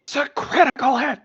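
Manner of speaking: high, excited game call; excited announcement. Real, not acted. Player announcing a critical hit to the table, excited. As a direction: call out the big hit, pitched right up.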